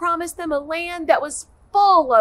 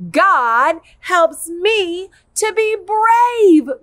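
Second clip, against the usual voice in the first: slow, louder and higher, chanted together; loud group recital; 5 semitones higher, 8 dB louder, much slower than usual. Shown as a demonstration. Teacher reciting the verse with the kids, loud slow chant. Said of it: "Are you ready?".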